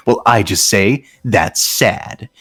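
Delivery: ordinary delivery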